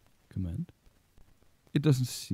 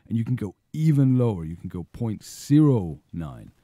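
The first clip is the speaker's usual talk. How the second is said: ordinary talk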